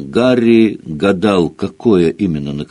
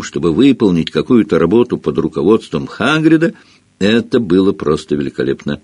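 ordinary delivery

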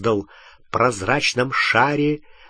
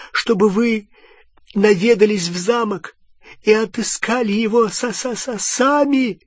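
high, hesitant servant voice; halting, apologetic suggestion that trips into a stammer; trembling, stammering plea; 8 semitones higher than usual. A narrator's reading. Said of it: "Голос Малфоя дрожал". (робкая просьба высоким голосом с заиканием)

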